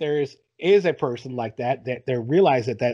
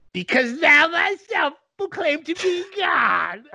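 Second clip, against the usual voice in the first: much higher, slow goblin croak; 12 semitones higher, 4 dB louder, slower than usual. Shown as a demonstration. Bible villain challenging Jesus, in a high goblin voice. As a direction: play a sneering goblin, drawn out. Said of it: "It's also like the goblin voice".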